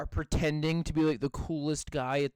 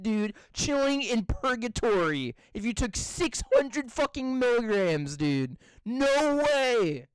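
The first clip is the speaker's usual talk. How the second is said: high, slow, disbelieving whine; incredulous; 8 semitones higher, slower than usual. Retold or imitated. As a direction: refuse to believe it, voice up high. Incredulous retort, high put-on voice.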